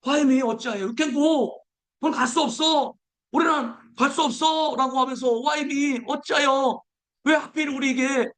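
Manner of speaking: high mock protest; complaining crowd.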